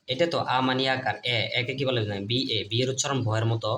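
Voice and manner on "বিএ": ordinary talk, nothing marked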